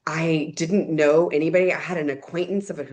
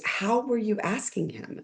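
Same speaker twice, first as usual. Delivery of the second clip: higher, softer, slow questioning quote; skeptical woman; 6 semitones higher, 5 dB quieter than usual. Retold or imitated. Dinner guest questioning the newcomer, slow and puzzled. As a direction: ask it like a puzzled local.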